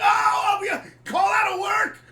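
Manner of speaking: high, loud cheering; over-the-top party yelling; wild celebration. Retold or imitated. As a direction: whoop it up, losing your mind. Both celebrating the win.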